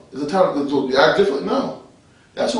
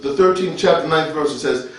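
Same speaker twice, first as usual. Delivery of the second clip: ordinary talk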